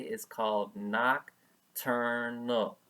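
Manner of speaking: each syllable drawn out; slow teaching voice. Shown as a demonstration. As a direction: spell it out slowly for children. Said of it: "Everybody say that with me".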